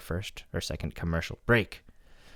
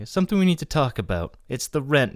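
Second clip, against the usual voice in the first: higher, lightly acted landlady; 7 semitones higher, 4 dB louder than usual. Retelling the landlady's demand, higher mock voice. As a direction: voice the pushy landlady a bit higher. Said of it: "His landlady comes downstairs and is like".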